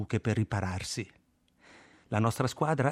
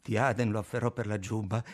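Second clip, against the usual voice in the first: ordinary delivery, nothing marked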